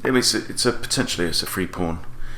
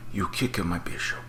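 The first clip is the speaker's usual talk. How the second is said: hushed, put-on Italian accent; playful Italian accent, dropped to near a whisper; 8 dB quieter than usual. Chess player mutters at opponent over bishop, hushed Italian accent. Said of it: "doing it in Italian accent".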